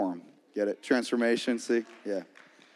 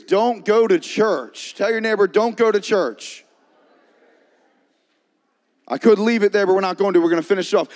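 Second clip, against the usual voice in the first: rally the congregation, voice raised; rousing preaching; 6 semitones higher, 5 dB louder than usual. Real, not acted. Pastor has congregation tell their neighbors the slogan, rousing preaching.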